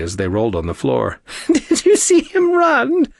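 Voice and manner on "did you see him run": high, loud squeak through laughter; squeaked between laughs. A narrator's reading. Arya mocking Nick, squeaking through laughs. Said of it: "Arya squeaked out between laughs".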